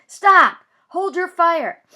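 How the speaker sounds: high, loud urgent order; yell. Acted. Police officer yelling at his men to hold fire. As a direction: shout to stop the shooting. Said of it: "voice yells".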